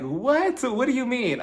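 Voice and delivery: high-pitched baffled outrage; hammed-up incredulous questioning; mock disbelief. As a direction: act out being taken aback. Retold reaction to tactless remark.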